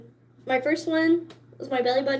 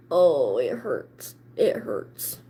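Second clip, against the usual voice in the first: much lower, slow, breathy hurt groan; mock pained whimper; 11 semitones lower, slower than usual. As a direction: moan it like the needle just went in. Reliving the eyebrow piercing pain.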